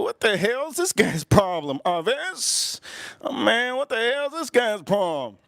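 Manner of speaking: acted exasperation; exasperated. Kid exasperated by the father.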